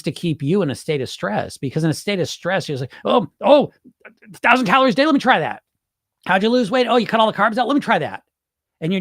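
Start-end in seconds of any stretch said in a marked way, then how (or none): 3.0-8.2 much higher and louder, grabbing at each diet fad; mock a frazzled dieter jumping at every plan; frantic dieter. Mocking the stressed dieter.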